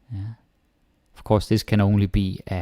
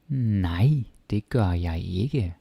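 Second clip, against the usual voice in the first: ordinary delivery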